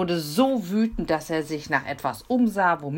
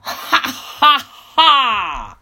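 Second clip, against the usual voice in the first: high, much louder booming ha ha ha; terrible laugh; 10 semitones higher, 12 dB louder, much slower than usual. Acted. Robber laughing terribly as he climbs in. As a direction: laugh like a gleeful bandit. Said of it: "Ungefähr so".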